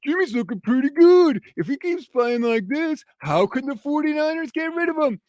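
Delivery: high, loud mock fan voice; parroting the hopeful fans sarcastically; sarcastic impression of hopeful fans. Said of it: "And people are saying".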